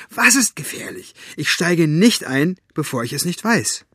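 high, insistent demand; indignant girl calling out. A narrator's reading. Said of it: "rief sie".

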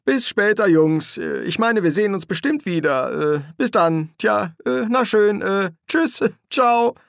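high, halting string of goodbyes; flustered, awkward babble; nervous farewell. Acted. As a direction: stumble through goodbye after goodbye at a high pitch. Crowley's nervous, rambling farewell.

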